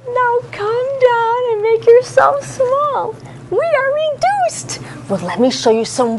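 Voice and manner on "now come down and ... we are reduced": squeaky small voice, drawn out slowly; tiny voice for little things; tiny high voice. Shown as a demonstration. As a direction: shrink your voice down small and high. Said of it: "in your little voice".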